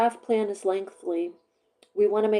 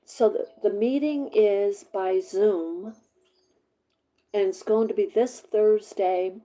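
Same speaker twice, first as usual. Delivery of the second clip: ordinary talk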